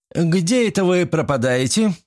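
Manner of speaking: sharp high shout across the yard. A narrator's reading. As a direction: call her out sharply. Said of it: "крикнул он".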